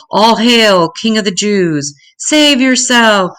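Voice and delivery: bow and jeer at the so-called king; mock shout. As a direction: soldiers shouting mock praise at the prisoner. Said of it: "and shouted".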